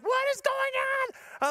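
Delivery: very high, drawn-out mock scream; imitating screaming wife. Wife screaming from the door at husband, high mock scream. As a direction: shriek it slowly as the frantic wife. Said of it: "She's screaming".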